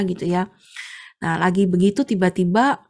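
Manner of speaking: ordinary talk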